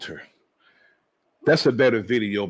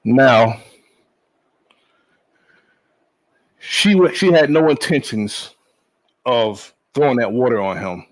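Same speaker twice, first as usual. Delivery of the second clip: raised, indignant voice; loud; 6 dB louder than usual. Real, not acted. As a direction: push it out loud and upset. Angry commentator protesting shooting.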